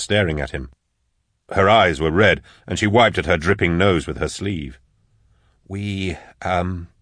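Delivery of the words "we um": soft, faltering start; hesitant. A narrator's reading. He begins to answer the staring Max, hesitant. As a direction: begin softly, unsure of the words.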